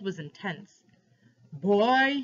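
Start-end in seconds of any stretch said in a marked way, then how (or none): 1.5-2.2 louder, higher shout; bellow. A narrator's reading. Man demanding the truth from the boy, bellowed. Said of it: "he bellowed".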